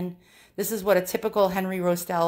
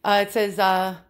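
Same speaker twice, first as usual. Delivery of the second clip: ordinary talk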